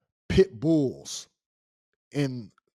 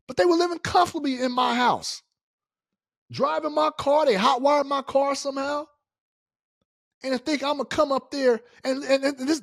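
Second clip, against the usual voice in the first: much higher and louder, indignant; real exasperation, pitched way up and loud; outraged rant; 10 semitones higher, 4 dB louder than usual. Real, not acted. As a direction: vent the outrage, voice climbing. Outraged about squatters using his car.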